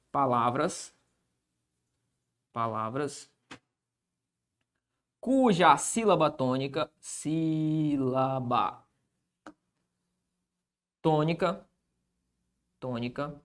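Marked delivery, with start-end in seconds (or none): 0.1-3.3 low, quiet dictation while writing; dictating. Real, not acted. Teacher writing out the definition, low dictation. 7.0-8.8 very slow, soft, drawn-out syllables; dictating. Real, not acted. Teacher writing the definition, slow dictation. Spelling out word while writing. 11.0-13.4 softly repeated word, trailing lower; dictating. Real, not acted. Teacher writing the definition, quiet dictation.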